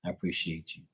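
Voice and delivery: hushed private thank-you, fading almost to nothing; murmured prayer of gratitude under the breath; inner thanks. Retold or imitated. Thanking God in his head after parking, very soft. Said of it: "in my mind".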